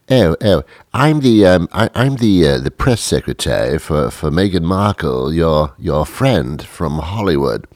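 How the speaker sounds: stammering British press secretary; British accent. Acted. Press secretary for Meghan Markle introduces herself, British accent. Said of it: "had a British accent".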